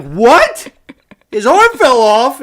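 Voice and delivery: high, loud, drawn-out disbelief; retold shock. Host re-enacts learning Gasly's arm broke, shocked outcry. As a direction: re-enact a stunned outcry with rising questions.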